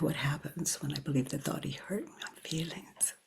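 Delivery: hushed breathy inquiry; whispered question. Shown as a demonstration. Teacher guiding the inquiry, whispered softly. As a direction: whisper it to yourself. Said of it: "inquiry question whispered".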